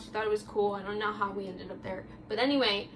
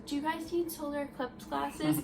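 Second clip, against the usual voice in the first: lightly voiced front desk lady, higher pitched; 4 semitones higher, 4 dB quieter than usual. Front desk lady offering eclipse glasses to guests, lightly imitated. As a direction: imitate a receptionist's polite question, lifted pitch. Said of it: "the lady at the front desk goes".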